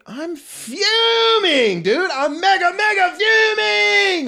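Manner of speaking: high, slow, loud mock rage; mock fuming. Real, not acted. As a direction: stretch out the fuming shout. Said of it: "genuine rage".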